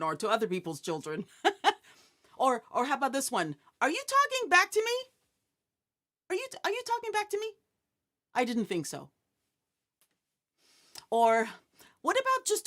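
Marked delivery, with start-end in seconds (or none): 3.8-5.0 quick, higher mock challenge; mock stern parent. Parent imitated challenging child who talks back, mock stern. 6.3-9.1 fast, higher, then dropping; mock stern parent. Parent imitated repeating the challenge to child, mock stern.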